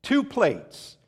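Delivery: high, slow pidgin English for the waiter; simple broken English. Retold or imitated. Husband speaking pidgin English to a waiter. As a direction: keep it childishly simple, yum yum. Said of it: "I'm just trying to keep it simple".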